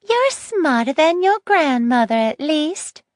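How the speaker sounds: put-on high, smiling spiteful old woman; a sweet smile over malice. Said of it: "she said with a smile".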